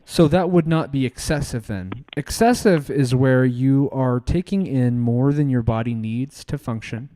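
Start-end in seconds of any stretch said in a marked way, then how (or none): none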